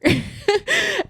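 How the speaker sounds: a high, real laugh bursting out; real high giggle; high burst of laughter. Real, not acted. Laughing at the movie.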